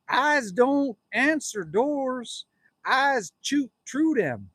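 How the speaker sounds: very slow, drawn-out mock warning. Streamer reads out his planned door sign, slow mock warning. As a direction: space out each word like a hand-painted threat.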